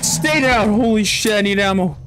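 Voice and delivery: high panicked shouting; out of ammo and screaming in fright; real yelling. Real, not acted. Player out of ammo yelling at attacking monsters, panicked shouts. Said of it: "I'm sorry for yelling".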